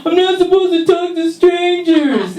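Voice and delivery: put-on wary little girl; cautious kid. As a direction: recite the stranger rule primly. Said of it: "I was like".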